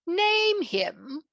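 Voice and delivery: high surprised question; king surprised by the question. Acted. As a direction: caught off guard, echo the words. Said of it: "replied King Arthur in surprise".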